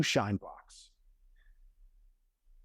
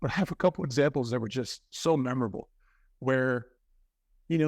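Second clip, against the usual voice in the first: usual speaking voice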